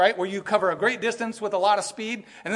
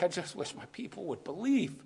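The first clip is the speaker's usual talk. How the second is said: much softer, hushed sorrowful voice; Jesus weeping over his people, hushed; 10 dB quieter than usual. Retold or imitated. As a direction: breathe it out as a grieving sigh. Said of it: "he's weeping like".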